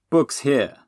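mild strain in the voice; grunted. A narrator's reading. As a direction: grunt it while straining to lift. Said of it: "Robin grunted".

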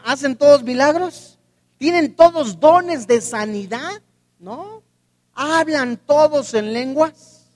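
loud, rising rhetorical questions; loud rhetorical preaching. Acted. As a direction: hammer each question at the congregation. (preguntas retóricas predicadas con fuerza)

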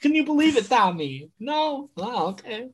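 gushing delighted tourist. Pretend. Imagining himself in an Italian town, high gushing tourist. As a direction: gush over everything like a wide-eyed tourist. Pretend tourist meeting locals.

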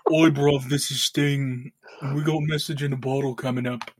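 mock [name] announcing. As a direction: introduce the song like a lazy London rock star. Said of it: "[name] out".

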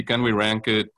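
usual speaking voice

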